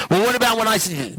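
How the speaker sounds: high-pitched imitation of protesting; frantic protest. Protesting as sponsor keeps cutting in.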